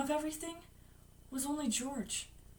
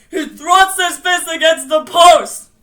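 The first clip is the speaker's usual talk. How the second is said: forceful, drawn-out declaiming shout; loud cry; 5 semitones higher, 16 dB louder, slower than usual. Acted. Bill defying the monster, crying out forcefully. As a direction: bellow it like someone else's voice. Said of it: "Bill cried. His voice was deeper".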